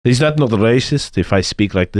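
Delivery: low, mock Russian accent. Shown as a demonstration. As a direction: keep up the deep Russian-man voice. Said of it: "You can speak like this, like a Russian man".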